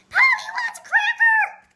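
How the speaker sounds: very high, loud squawk; screeching parrot. A narrator's reading. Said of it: "a parrot screamed".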